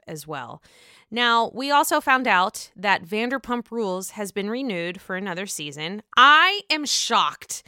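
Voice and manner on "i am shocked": mock shock.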